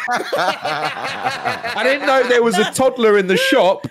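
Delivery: laughing at his own mishap. Real, not acted. Laughing while telling funny story.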